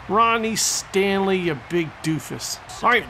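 normal talking voice

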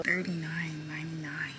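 muttered price in a whisper. Real, not acted. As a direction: whisper it under breath. Reading price tag.

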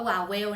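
"vowel" misread as "wa-wel"; wrong pronunciation. Shown as a demonstration. Teacher jokingly giving another misreading, "vowel" said as "wa-wel". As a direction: swap the V of "vowel" for a W, two clear syllables. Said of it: "สระไม่ได้อ่านว่า".

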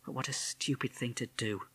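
soft, private disapproval; quiet exasperated thought; inner thought. A narrator's reading. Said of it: "Missus Holsworth thought".

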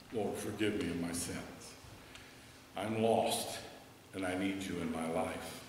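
normal talking voice

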